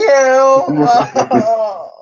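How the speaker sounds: loud burst of laughter. Real, not acted. Laughing at his own jab at his co-host, loudly.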